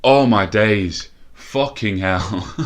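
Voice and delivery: loud, stretched-out gasp of disbelief; stunned relief. Real, not acted. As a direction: let the shock drag out slowly. Own goal relief after miss.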